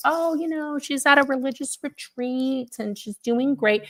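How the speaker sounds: high, airy brush-off; smooth it over like nothing's wrong; a mother brushing off the caller, light and high; breezy mom. Retold or imitated. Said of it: "my mom was like".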